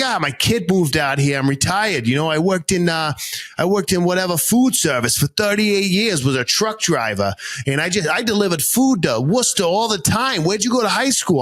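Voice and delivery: easygoing thick Boston; Boston accent. Retold or imitated. Driver telling his life story, Boston accent. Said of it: "switch accents quick".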